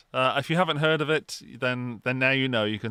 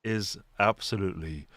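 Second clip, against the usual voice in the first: imitating his deep voice; 9 semitones lower, 5 dB quieter than usual. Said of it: "his voice".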